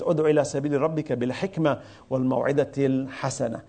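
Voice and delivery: quick chanted Arabic recitation; quranic recitation. Acted. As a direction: recite the verse rapidly in Arabic. Quoting Quran verse in Arabic.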